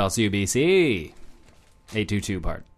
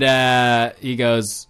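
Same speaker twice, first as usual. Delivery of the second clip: ordinary delivery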